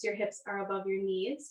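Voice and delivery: ordinary talk, nothing marked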